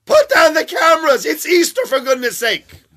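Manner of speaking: very high, loud mock scold. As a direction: parody an outraged busybody. Mocking imagined Christmas scold.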